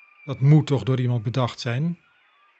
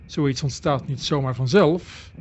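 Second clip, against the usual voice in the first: ordinary delivery, nothing marked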